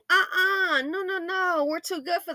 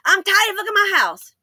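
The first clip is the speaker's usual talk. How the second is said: mock fed up; 7 semitones higher, 8 dB louder than usual. Acted annoyed homeowner.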